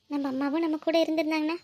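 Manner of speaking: soft, subdued longing; hushed, sorrowful musing with pauses; wistful sadness. Acted. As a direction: confide a quiet ache for an absent mother. Sisters privately long for absent mother.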